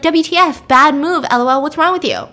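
mock an annoyed opponent's message; scolding message.

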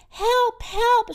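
high, thin-volume cry for help; faraway shout. A narrator's reading. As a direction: call for help as if from far off. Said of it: "shouted a faraway voice".

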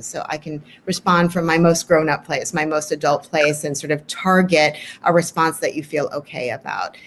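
normal talking voice

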